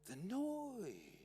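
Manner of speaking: high, soft, long drawn-out mock agony; pained hushed moan stretching the last word; very quiet, pained.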